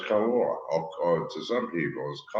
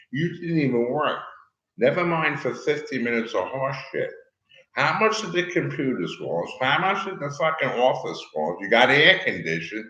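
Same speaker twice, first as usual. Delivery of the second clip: louder and higher, complaining about the bill; angry rant; 8 semitones higher, 6 dB louder than usual. Real, not acted. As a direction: push it out, indignant and heated. Ranting about a bill he was charged.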